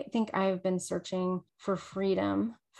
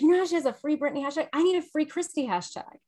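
excited outburst; 7 semitones higher, faster than usual. Retold or imitated. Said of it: "I was like".

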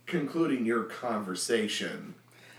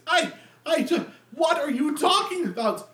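much higher, louder panicked stammer; stammering deacon; 5 dB louder than usual. Acted. The deacon stammers at Minsk's dagger, high panicked. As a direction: stutter in terror.

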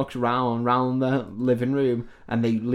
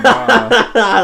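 mock pain yelp; 10 semitones higher, 12 dB louder than usual. Freddy hit by the hammer.

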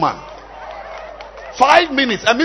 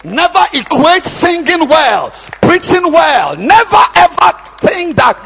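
loud, higher, slow preaching voice; fiery preaching; 6 semitones higher, 6 dB louder than usual. Acted. Pastor warns the congregation, preaching at full force. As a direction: hammer the warning home.

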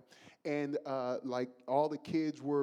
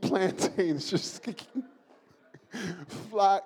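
giggling through the words, slowed; laughing; 4 semitones higher, 7 dB louder, slower than usual. Real, not acted. Preacher cracking up telling the congregation about plantains. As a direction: laugh helplessly through the punchline.

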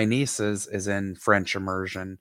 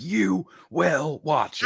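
slow, emphatic order, pitched up; mock command; 4 semitones higher, slower than usual. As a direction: insist word by word, slowly. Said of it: "not a show where I'm like".

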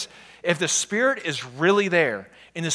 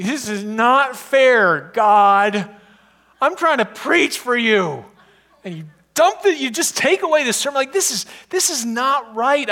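higher, louder exasperated complaint at God; ticked-off rant; 9 semitones higher, 7 dB louder than usual. Retold or imitated. Pastor reliving his angry rant at God, exasperated. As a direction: vent frustration loudly upward. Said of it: "I was ticked off".

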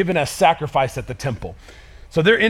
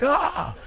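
mock yelp; 11 semitones higher, 7 dB quieter than usual. Shepherds scared again.

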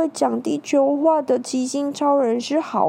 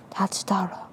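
whisper; 3 semitones lower, 6 dB quieter than usual. A narrator's reading. Quiet realisation.

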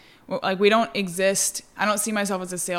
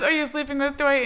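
imitating Andy; 7 semitones higher than usual. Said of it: "When he says".

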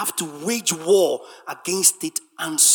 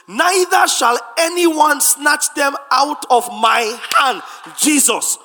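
loud, rising sing-song preacher's cadence; full-force preaching; 7 semitones higher, 10 dB louder than usual. Acted. Preacher proclaiming the Father's greatness to the church, loud chanting. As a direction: hammer every line like a refrain.

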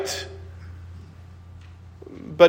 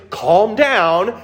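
higher, louder, patronizing soothing; condescending "calm down"; 12 semitones higher, 4 dB louder than usual. Retold or imitated. Someone hushing an upset friend, mock patronizing and louder. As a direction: talk down to someone who's upset. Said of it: "and you say".

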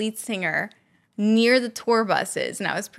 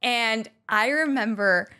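usual speaking voice

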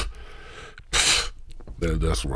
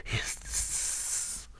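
soft unvoiced hiss; whispered hiss; 11 dB quieter than usual. Mock God ending his words with a soft whispered hiss. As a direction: let a snake-like hiss out. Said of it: "God's supposed to hiss".

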